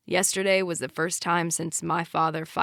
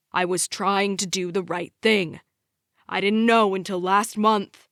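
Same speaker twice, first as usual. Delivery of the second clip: loud, heated rant at wife; a drunk husband berating his wife, loud and bitter; angry rant; 2 semitones higher, 6 dB louder than usual. Acted. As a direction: keep hammering her with blame. Drunk dad yelling at wife.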